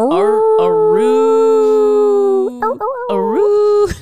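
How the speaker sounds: high, loud howling a roo; wolf voice. Retold or imitated. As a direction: howl it like a dog. Imitating dog howling at them.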